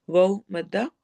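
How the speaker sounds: ordinary delivery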